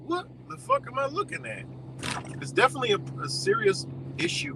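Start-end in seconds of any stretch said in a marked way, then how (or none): 0.1-1.6 can't believe your eyes; high, astonished outburst at the men standing around; stunned disbelief. Retold or imitated. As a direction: pitch shoots way up in shock. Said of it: "I'm like".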